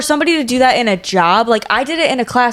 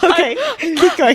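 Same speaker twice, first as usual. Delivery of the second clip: laughing; 7 semitones higher than usual. Real, not acted. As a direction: read it while cracking up. Laughing reading.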